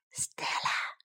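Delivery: hushed, unvoiced whisper. Sam whispers to Stella at bedtime, whispering. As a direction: whisper the name softly. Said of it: "whispered Sam".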